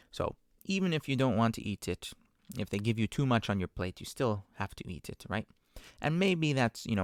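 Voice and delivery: ordinary delivery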